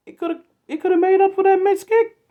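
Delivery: yelled at the top of the voice, very high and loud; furious shout. Real, not acted. Fan screaming at the coach about the missed kick, furious.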